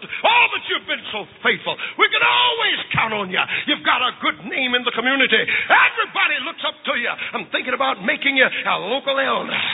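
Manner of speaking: priest laying it on thick; fawning priest. Acted. Priest keeps flattering John.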